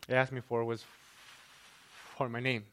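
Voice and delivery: ordinary talk, nothing marked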